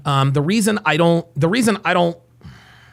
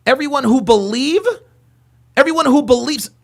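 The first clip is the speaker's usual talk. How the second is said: higher-pitched mimicry of a speechmaker; mock his fake inflections, pitched up; mocking impression; 8 semitones higher, 3 dB louder than usual. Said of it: "Do you hear his fake voice inflections?".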